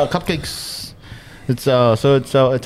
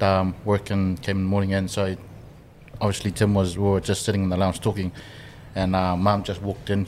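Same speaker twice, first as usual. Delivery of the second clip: ordinary delivery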